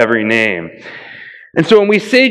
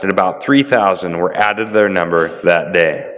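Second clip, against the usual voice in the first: measured Bible reading, mostly a bit lower; scripture reading; 5 semitones lower than usual. Acted. Pastor reading Acts aloud to the congregation, measured and solemn.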